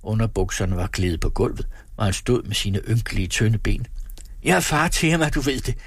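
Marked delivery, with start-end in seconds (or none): 4.4-5.8 high, self-important declaration; pompous, aggrieved insistence; indignant boasting. A narrator's reading. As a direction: proclaim it proudly in a raised pitch. Acted quarrel, man asserting fatherhood.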